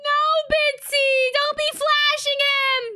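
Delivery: high, loud playful scold at Bitsy; excited scolding. Real, not acted. Streamer shouting at her character to stop flashing. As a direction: yelp it at her to knock it off.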